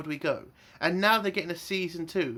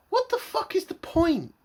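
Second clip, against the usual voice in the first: exasperated outburst; 12 semitones higher than usual. Real, not acted. Angry rant about the rescue.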